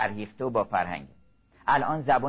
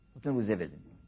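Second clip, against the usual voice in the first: soft echo; 3 semitones lower, 7 dB quieter, faster than usual. Real, not acted. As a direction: repeat it softer and lower, like an echo to yourself. Repeats the line softly as an echo. (تکرار آرام و نجواگونه)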